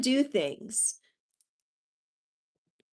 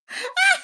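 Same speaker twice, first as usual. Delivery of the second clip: high squeal of laughter; a short high laugh; real delighted laugh; burst of laughter. Real, not acted. Laughing at the siding joke.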